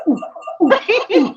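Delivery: high hooting ooh; mock ghost moan. Second caller imitated with high ghostly moans. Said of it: "And he was like".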